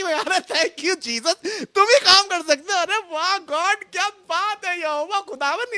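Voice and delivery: high, softened gushing parody; mock praise. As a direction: imitate fawning worshippers, pitched well up. Parodies people's gushing praise to God. (ऊँची, धीमी आवाज़ में दिखावटी वाहवाही)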